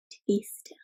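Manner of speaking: hushed whisper. A narrator's reading. Reading the calm moment to children, whispered. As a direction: breathe it out as a whisper.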